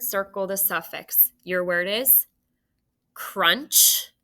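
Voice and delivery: ordinary talk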